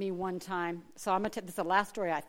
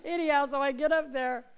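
laughing; 11 semitones higher, 13 dB louder than usual. Real, not acted. Laughing after fudge joke.

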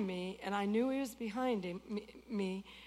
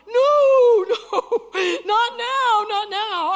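loud laughing protest; 10 semitones higher, 14 dB louder, much slower than usual. Retold or imitated. Pleading with Jesus, laughing.

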